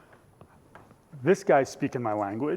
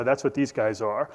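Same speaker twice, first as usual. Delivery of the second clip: ordinary talk, nothing marked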